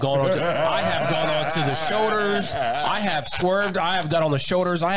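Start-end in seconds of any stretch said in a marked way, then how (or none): 0.0-2.5 laughing through the confession; sheepish laughing admission. Real, not acted. Laughing confession of bad driving. 2.5-3.8 slow, drawn-out admission; deliberate slow confession; slow emphasis. Real, not acted. Admitting bad driving.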